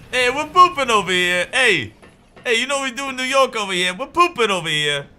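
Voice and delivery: put-on loud, high, brash New York accent. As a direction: shout it like a pushy New Yorker. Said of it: "you just do a New York accent".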